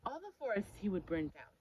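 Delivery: usual speaking voice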